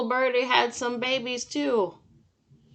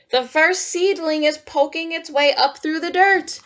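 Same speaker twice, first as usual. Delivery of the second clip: higher and louder, full of wonder; delighted storytime reading; 6 semitones higher, 7 dB louder than usual. Acted. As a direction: read it as a happy discovery for kids. Reads storybook line with delight.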